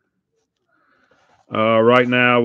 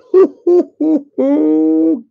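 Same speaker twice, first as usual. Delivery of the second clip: a sudden high-pitched squeal of laughter; 6 dB louder than usual. Real, not acted. Opening packs, cracking up at his own joke with a high squeal. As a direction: burst into a shrill laughing squeal.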